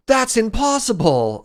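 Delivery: hammed-up disbelieving shout; Luke's "That's impossible!" as a hammed-up yell; shocked Luke. Luke's shocked line acted out.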